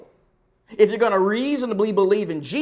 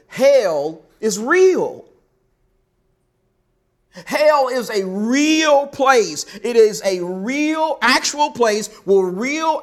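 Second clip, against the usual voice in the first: each word slow, louder and higher, leaning hard on real; slow emphatic insistence; 5 semitones higher, 4 dB louder, slower than usual. Real, not acted. Preacher to his congregation, slow, louder, emphatic insistence. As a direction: pound home that hell is real. Preacher drives home hell's reality.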